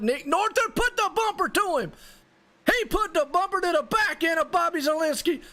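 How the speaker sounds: screaming the last-lap wreck home; excited race call. Real, not acted. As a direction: yell the finish like the crowd's on its feet. Photo finish, crash at the line.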